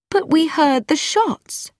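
high-pitched, insistent female character; protesting. A narrator's reading. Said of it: "said Mildred".

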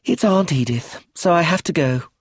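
put-on a little girl reluctantly giving in; sighing child. A narrator's reading. Child called away by her aunt, sighing. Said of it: "Josephine sighed".

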